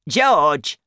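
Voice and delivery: loud, demanding call of a name; cross old grandma yelling; grandma's shout. A narrator's reading. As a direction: holler for the boy. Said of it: "she shouted".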